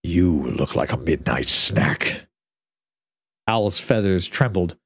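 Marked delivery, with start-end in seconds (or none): 0.0-2.3 very low, slow dragon drawl; looming over a tiny owl; deep dragon voice. A narrator's reading. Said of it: "said the dragon".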